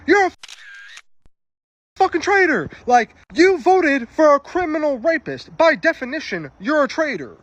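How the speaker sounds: high-pitched re-enacted yelling; retold scream. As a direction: hurl it at the other driver, high and insistent. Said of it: "I screamed".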